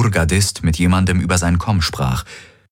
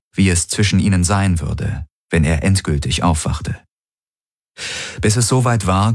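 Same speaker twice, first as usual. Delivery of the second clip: ordinary delivery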